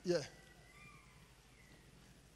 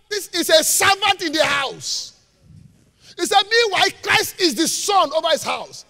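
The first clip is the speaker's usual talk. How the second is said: loud, high, emphatic proclamation; full-force preaching; 9 semitones higher, 12 dB louder than usual. Real, not acted. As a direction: drive each point home at shouting volume.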